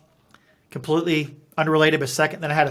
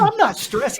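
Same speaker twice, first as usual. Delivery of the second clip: much higher, laughing protest; laughing denial; 11 semitones higher than usual. Retold or imitated. To the doctor, denying he is stressed, with a laugh. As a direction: deny it while laughing at yourself.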